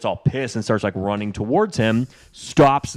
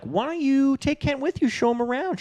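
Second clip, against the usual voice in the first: cheery mom. Retold or imitated. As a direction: suggest it in a high motherly voice. Voicing the mom's suggestion.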